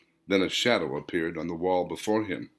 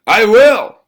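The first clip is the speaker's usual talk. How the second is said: a much higher, much louder burst; sudden shout; 12 semitones higher, 14 dB louder than usual. Acted. Husband jolts up and shouts to the reflection, sudden loud shout. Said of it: "Her husband sat up and shouted".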